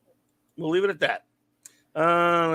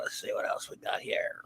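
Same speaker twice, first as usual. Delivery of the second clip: soft unvoiced whisper; 13 dB quieter than usual. Real, not acted. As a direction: whisper it to yourself. Whispered aside.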